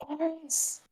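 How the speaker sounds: soft, high, half-whispered call; attempted whisper. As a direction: call the name softly in a voiced stage whisper. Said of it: "Marlo is going to start whispering now".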